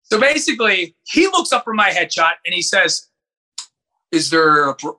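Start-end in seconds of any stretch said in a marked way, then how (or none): 4.1-5.0 lightly lowered, imposing man's voice; a hint of deep-voiced authority; imitated deep voice. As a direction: drop into a mock deep voice. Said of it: "well, with his deep voice".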